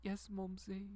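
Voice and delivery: a soft posh British Mumsy; reply in a mock British accent; child answering his mother in a British accent; cheeky British accent. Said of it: "And I said".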